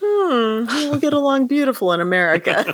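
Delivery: high, quick, lightly voiced waiter; a touch of a dry, amused waiter; wry waiter [name]. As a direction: toss off a dry joke. [name]'s dry reply.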